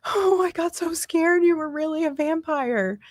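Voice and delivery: put-on high little girl voice; high child voice. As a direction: speak as the relieved six-year-old, up high. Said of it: "she was like".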